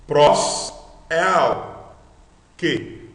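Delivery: loud, separate syllables with gaps; syllables apart. Shown as a demonstration. Teacher splits compound word, syllables loud and apart. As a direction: punch out "prós", "el", then pause before "que". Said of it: "Ou você lendo tudo numa unidade de som".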